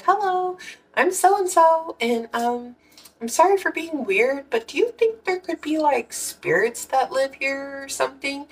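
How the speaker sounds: very high, sing-song polite self-imitation; sheepish polite voice. Asking a neighbor about spirits, high sheepish politeness.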